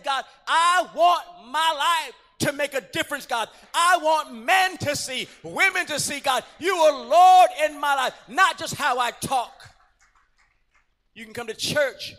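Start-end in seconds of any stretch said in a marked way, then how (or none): 0.0-9.7 loud, higher impassioned prayer cry; full-force prayer preaching. Acted. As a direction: cry out your stand to God.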